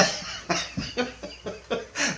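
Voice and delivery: long breathy chuckle; amused laugh. Real, not acted. Laughing at the retold threat, amused. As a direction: laugh at your own story.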